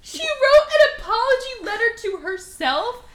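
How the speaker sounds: loud, high, outraged disbelief; real incredulity. Real, not acted. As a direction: exclaim it as if it's absurd. Outraged at the absurd plot.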